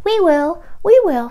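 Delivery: high, loud peeping chick voices; baby chick voices. Acted. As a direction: squeak it out like tiny chicks. Eager baby chicks answer.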